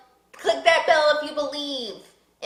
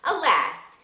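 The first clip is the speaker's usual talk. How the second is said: plain reading voice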